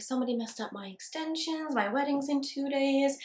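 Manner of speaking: mock a distressed client, small and hushed; retold tearful plea. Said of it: "in tears, crying".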